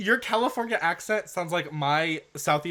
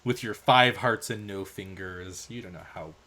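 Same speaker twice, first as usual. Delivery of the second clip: lower, soft, sneering put-down; condescending sneer; 7 semitones lower, 6 dB quieter than usual. Pretend. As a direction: talk down to the audience. Mock degrading, low.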